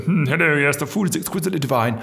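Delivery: lightly put-on plummy posh English; a hint of snooty upper-class English; mildly mock posh accent. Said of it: "really posh and, like, really snooty".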